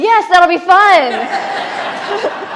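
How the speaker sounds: high, loud and very slow, through laughter; laughing cheer. Shown as a demonstration. Teacher leading the class, laughing and cheering.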